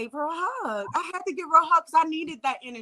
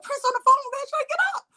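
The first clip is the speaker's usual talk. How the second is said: much higher, insistent manager's voice; urgent wake-up call; 14 semitones higher than usual. Retold or imitated. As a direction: shake a sleeper awake with your voice. Manager urgently waking her, acted.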